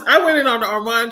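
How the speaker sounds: ordinary talk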